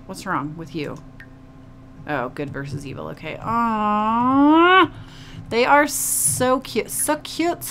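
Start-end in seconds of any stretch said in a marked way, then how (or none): 3.4-7.6 cooing. Real, not acted. Cooing at cute Sims.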